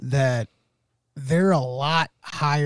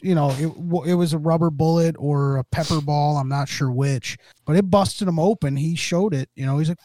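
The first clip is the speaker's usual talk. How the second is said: ordinary delivery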